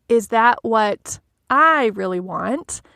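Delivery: slow question with I set apart and stretched; pause, then lean hard on I; stress on I. Shown as a demonstration. Coach asking herself about a full schedule, stress on I. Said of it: "asking ourselves that question with that emphasis".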